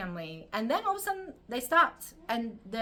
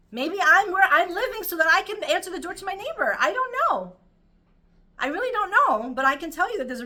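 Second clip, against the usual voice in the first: higher and louder, rising fervour; impassioned preaching; 8 semitones higher, 10 dB louder than usual. Real, not acted. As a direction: preach it with full passion. Passionate sermon peak.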